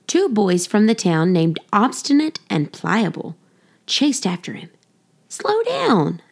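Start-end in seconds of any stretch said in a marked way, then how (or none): 5.3-6.2 high yell to slow down. A narrator's reading. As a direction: shout after him, pitched way up. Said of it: "they yelled".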